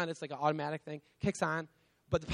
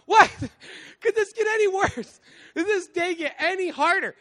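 high, loud disbelieving cry; exasperated outburst; 13 semitones higher, 10 dB louder than usual. Retold or imitated. Dad hit by one more disaster, exasperated shout. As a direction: throw your hands up at a day gone wrong. Roof gone, daughter peed.

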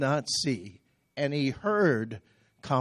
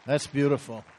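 normal talking voice

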